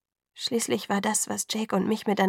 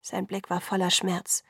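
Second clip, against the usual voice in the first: plain reading voice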